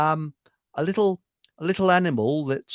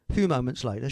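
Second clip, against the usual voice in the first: normal talking voice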